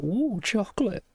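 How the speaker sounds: excited. Retold or imitated. Tempted by an Easter egg.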